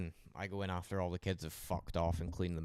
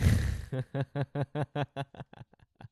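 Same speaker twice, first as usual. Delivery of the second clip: loud laugh; 7 semitones higher, 7 dB louder than usual. Real, not acted. Laughing at junkie joke.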